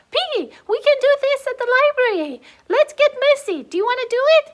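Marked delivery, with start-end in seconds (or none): none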